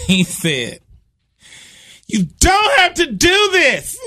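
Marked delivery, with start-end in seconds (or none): 2.1-4.0 high-pitched drawn-out mock plea; desperate pleading. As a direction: wail it loudly, begging for your life.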